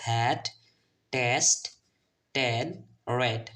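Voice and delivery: "হ্যাড", "টেস্ট", "টেন", "রেড" separately with open eh. Shown as a demonstration. Teacher recaps the list for learners, "হ্যাড" to "রেড" distinctly with eh. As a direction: model "হ্যাড" to "রেড" word by word, vowel as eh. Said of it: "ই এর উচ্চারণ এখানে এ এর মতো".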